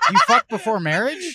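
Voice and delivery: high, loud, laughing mock shock; mock outrage. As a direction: gasp it out laughing in fake scandal.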